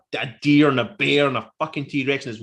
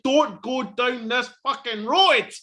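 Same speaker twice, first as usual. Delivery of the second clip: high, slow exasperated holler; screaming at the screen; 8 semitones higher, 3 dB louder, slower than usual. Retold or imitated. Fan warning the film kids away from the road, exasperated yell. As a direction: stretch each word in an exasperated plea. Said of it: "you're screaming at the screen".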